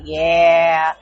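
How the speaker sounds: low, drawn-out confirmation; deadpan son, imitated. Son telling classmates about his angry mom, low deadpan imitation.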